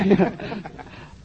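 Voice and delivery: high amused laugh; real laugh. Real, not acted. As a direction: burst out laughing after the punchline. Laughs after the quoted punchline.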